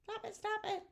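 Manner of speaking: hammed-up annoyed child whining stop it; mock angry daughter. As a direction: play the exasperated daughter. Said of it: "my daughter would get so mad".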